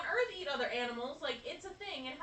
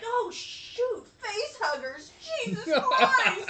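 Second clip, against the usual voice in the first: higher, louder shocked cry with a laugh; alarmed yell; 10 semitones higher, 11 dB louder, much slower than usual. Retold or imitated. Viewers spot face huggers, laughing alarmed shout. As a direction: shout in mock panic at the screen. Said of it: "we're all like".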